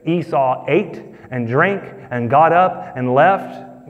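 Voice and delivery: each word laid out slowly; slow emphatic reading. Shown as a demonstration. Preacher reading scripture to congregation, slow and deliberate. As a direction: read it slow so they hear it. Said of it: "listen to the words again".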